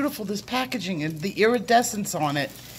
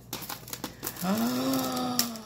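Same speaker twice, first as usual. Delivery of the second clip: long, drawn-in gasp of awe; real, softer sucked-in gasp; amazed gasp; 2 semitones higher, 6 dB quieter than usual. Real, not acted. Unboxer overwhelmed by the gift, long amazed gasp.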